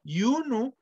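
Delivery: "Yunu" agudo, con el tono cayendo al final; nombre egipcio pronunciado. Shown as a demonstration. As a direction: di "Yunu" alto y claro, bajando al final. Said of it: "el nombre de la ciudad". ("Yunu", nombre de la ciudad en tono agudo)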